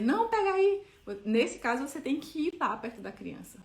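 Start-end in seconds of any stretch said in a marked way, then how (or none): 0.0-0.8 grito alto e agudo de alerta. Retold or imitated. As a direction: grite para a criança parar já. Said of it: "em vez de você dizer". (mãe grita de longe para a filha na tomada)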